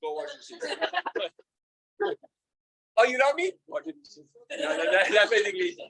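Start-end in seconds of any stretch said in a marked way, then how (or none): 0.0-2.2 high, slow, laughing wife voice; laughing mock wife, dragged out; demanding wife. Wife's reply acted out. 3.0-4.2 high mock French wife. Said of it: "she's very French about it".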